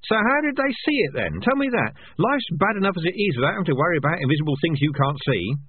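too scared, so mock everything; nervous sarcasm. A narrator's reading. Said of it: "nervous to the point of mad sarcasm".

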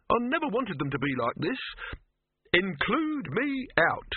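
high-pitched, agitated complaint; distressed protest. A narrator's reading. Silverfish quits Dibbler's moving pictures, upset.

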